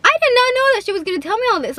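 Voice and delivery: high, breathless and fast; excited rush. Retold or imitated. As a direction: blurt it out in amazement. Amazed rush of disbelief.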